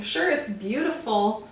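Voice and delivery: usual speaking voice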